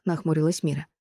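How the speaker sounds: ordinary delivery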